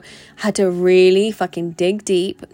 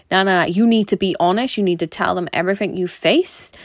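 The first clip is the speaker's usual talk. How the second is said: usual speaking voice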